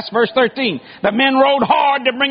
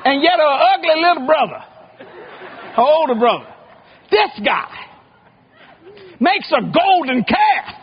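ordinary talk, nothing marked

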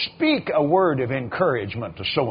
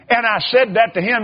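ordinary talk

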